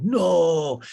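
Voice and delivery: high, loud, stretched-out no; mock dismayed cry. Bar owners hearing the press is coming early, mock dismay. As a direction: wail it in mock alarm. [name]' acted reaction to deadline.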